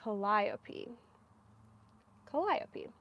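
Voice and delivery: Calliope softly repeated as kuh-LIE-oh-pee; accent on LIE. Real, not acted. Knitter rehearsing the name, Calliope softly as kuh-LIE-oh-pee. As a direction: practise Calliope quietly, stressing the second syllable. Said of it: "The accent is on the lie".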